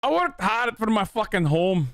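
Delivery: put-on Scottish accent. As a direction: stress "worked" in a hammed-up Scottish accent. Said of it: "Is that good?".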